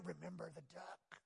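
gloating whispered reminder. Acted. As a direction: sly whisper with a little laugh. Sister whispers blackmail again.

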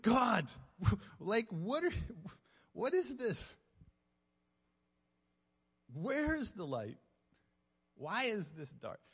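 high, loud pleading outcry; anguished cry to God. Retold or imitated. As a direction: wail the questions heavenward, slowing toward the end.

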